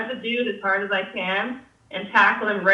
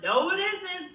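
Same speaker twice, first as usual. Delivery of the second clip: high but softer mock denial; insistent contradiction; 8 semitones higher, 5 dB quieter, slower than usual. Two guys denying the camper's claim again, high, softer insistence. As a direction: imitate a stubborn reply, pitched up. Acted quarrel over the tent.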